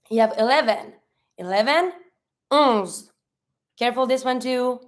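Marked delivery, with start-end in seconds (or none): none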